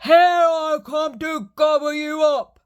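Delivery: loud, high troll bellow; loud troll leaping at the big goat. Acted.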